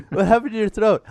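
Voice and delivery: repeating the question mid-laugh. Real, not acted. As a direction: laugh through the whole question.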